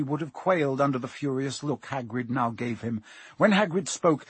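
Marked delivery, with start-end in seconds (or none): none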